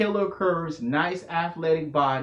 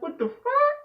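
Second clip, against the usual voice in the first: sudden high squeak of alarm; startled disbelief. Retold or imitated. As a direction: yelp it in surprise. Woman starts banging on him.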